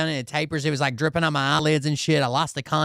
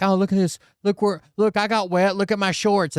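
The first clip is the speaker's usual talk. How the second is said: higher-pitched imitation of a complainer; mock whiner; 6 semitones higher than usual. Imitating brother-in-law showing off wet shorts, mock whining. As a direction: mimic someone fussing over a little wetness. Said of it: "he was like".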